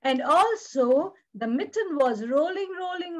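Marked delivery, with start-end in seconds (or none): none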